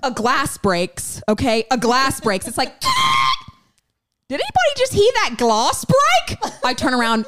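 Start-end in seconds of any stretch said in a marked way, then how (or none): 2.8-3.5 sudden high shrieking laugh. Real, not acted. Loud high laugh at glass break. 4.3-6.4 high, startled call-out; alarmed. Retold or imitated. Alarmed quote, high, far.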